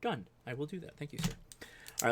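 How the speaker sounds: normal talking voice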